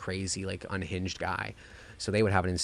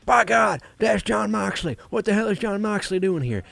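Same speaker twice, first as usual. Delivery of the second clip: screaming commentator, much higher and louder; Jim Ross call; 13 semitones higher, 10 dB louder than usual. Retold or imitated. Fan mimicking Jim Ross's shocked ringside call at full yell. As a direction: belt it like a stunned play-by-play man. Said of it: "going like".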